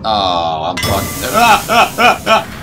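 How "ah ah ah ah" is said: sharp high screams in bursts; real startled shrieks; panicked yelps. Real, not acted. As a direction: yelp as the thing lunges. Jump scare in horror game.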